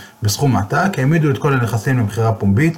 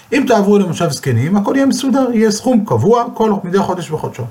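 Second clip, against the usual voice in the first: lightly voiced pushy son, higher pitch; 9 semitones higher than usual. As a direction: sell the old-age home with a high push. Son pressing parents to leave.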